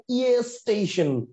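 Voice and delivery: "ইয়ে স্টেশন" drawn out slowly with a leading ইয়ে; slow demo pronunciation. Shown as a demonstration. Teacher slowly sounding out the harder pronunciation. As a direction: stretch out ইয়ে before স্টেশন. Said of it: "উচ্চারণটা কি হয়?".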